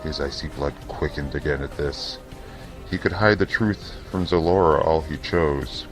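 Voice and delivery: usual speaking voice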